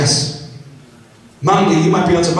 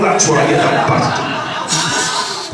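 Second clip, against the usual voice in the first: laughing insult; much slower than usual. Retold or imitated.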